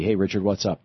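normal talking voice

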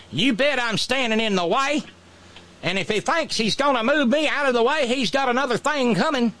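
high, hammed-up Southern defiance; Governor Meemaw. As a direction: play the defiant governor big and folksy. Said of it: "Governor Meemaw ... said".

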